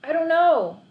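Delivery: put-on worried old lady. A narrator's reading. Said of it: "Missus McCluskey said".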